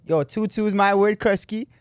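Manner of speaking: put-on loud, high-pitched Toronto slang accent; imitated Toronto accent, high and punchy. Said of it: "like the Toronto accent".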